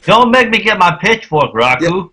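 high-pitched mock Southern threat; hammed-up Southern twang; Southern accent. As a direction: play a riled-up Southern country girl. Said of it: "I kinda like Southern girls".

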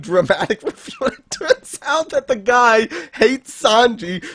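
slow, broken up by real laughter; laughing while talking. Real, not acted. Retelling the bad wanted poster gag, really laughing through it. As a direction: struggle to get it out through laughter.

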